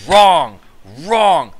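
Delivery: two loud, punched cries of "Wrong"; emphatic mock verdict. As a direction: blare it out like a game-show judge. Mock verdict in his story.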